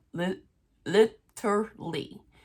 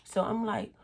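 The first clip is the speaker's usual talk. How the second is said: usual speaking voice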